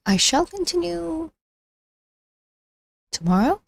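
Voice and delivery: ordinary talk, nothing marked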